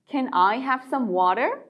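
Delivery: "water" lifted at the end, slowly; slow repeat, rising end. Shown as a demonstration. (끝을 올리며 천천히 반복)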